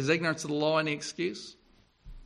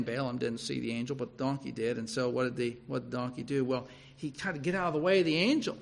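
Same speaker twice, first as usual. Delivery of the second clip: usual speaking voice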